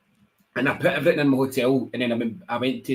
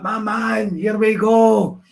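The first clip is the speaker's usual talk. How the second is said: high, slow, drawn-out hype; hyped greeting; 8 semitones higher, 5 dB louder, much slower than usual. Retold or imitated. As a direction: stretch it out like a big entrance. Hyped greeting, acted in story.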